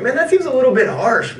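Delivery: high put-on whiny voice; lightly mocking Chinese official; mild complaint. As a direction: raise the pitch to sound wronged. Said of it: "the Chinese were like".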